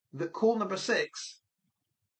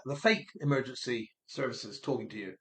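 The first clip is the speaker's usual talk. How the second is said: ordinary delivery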